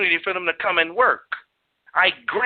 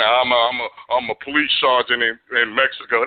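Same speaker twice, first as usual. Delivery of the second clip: lower, halting claim of importance; a hint of a self-important officer stumbling; lightly voiced Mexican sergeant; 9 semitones lower than usual. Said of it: "He said".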